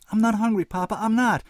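begging through near tears; boy near tears. A narrator's reading. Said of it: "He was almost in tears".

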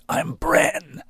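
unvoiced rasp, squeezed by crushed ribs; breathless pained rasp. A narrator's reading. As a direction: force the name out in pain. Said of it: "he rasped painfully".

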